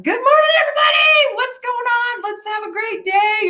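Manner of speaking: hammed-up morning person, bursting with energy; bouncing off walls. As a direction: sing out an over-the-top cheery wake-up call. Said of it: "just bouncing off the walls".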